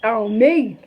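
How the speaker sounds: ordinary delivery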